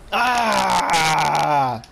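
long, falling "ah" shout; loud yell. Real, not acted. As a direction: holler it out in the heat of play. Yell during play.

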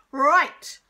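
sudden very loud shout; teacher's explosive cut-in. A narrator's reading. Teacher cuts pupil off, shouting. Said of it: "shouted Mister Irons".

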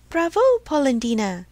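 a tiny voice calls bravo, lightly voiced; tiny voice crying out. A narrator's reading. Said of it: "cried the same tiny voice".

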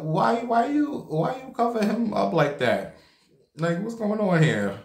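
reliving the shock of finding out; shocked disbelief. Retold or imitated. Asking the nurse why the dead man is covered, shocked and high.

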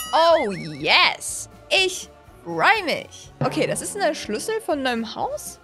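ordinary talk

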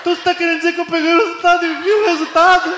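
high-pitched incredulous question; dawning disbelief. Acted. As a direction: ask it high as the mistake sinks in. Ricardo realizing his mistake.

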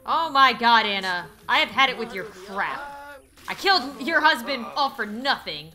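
fed up. Acted. Jilted suitor fed up with Anna, loud exasperation. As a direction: complain loudly, at the end of your patience.

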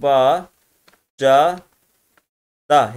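ba, ca, da said one by one, each ending on its vowel; syllables spelt out. Shown as a demonstration. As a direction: show each open syllable slowly. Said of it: "hepsi sesli harfle bitiyor". (heceleri tek tek, açık sesliyle bitirerek)

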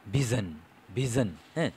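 ভিশন with a buzzing voiced zh in the middle; voiced sound demo. Shown as a demonstration. Teacher shows students the vocal cords vibrating on ভিশন. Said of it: "দেখো vocal কর্ডটা কী পরিমাণে কাঁপছে".

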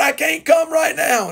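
high-pitched imitation of a boy calling out; pleading little boy. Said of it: "And Johnny said, "Mama".

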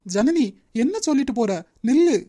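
loud, startled demand to wait; alarmed calling after. Acted. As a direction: call out to stop someone leaving. Calls after her as she leaves.

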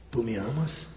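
low, soft, intimate question; hushed, coaxing question; charismatic. Acted. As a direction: ask gently and privately, pitched right down.

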